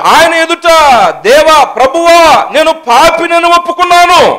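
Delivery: loud, high-pitched sermon delivery; full-force preaching. Real, not acted. Interpreter voices a preacher's testimony, preaching at full force. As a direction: belt out the confession to the congregation.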